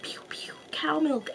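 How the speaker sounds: hushed, playful pew-pew sound effects; mock shootout noises. Imagining farm gangs squirting milk at each other, hushed mock battle. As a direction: play-act a tiny whispered battle.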